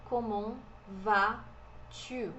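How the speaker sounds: Comment vas-tu, very slow, word by word; silent letters demo. Shown as a demonstration. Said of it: "O S também não é pronunciado".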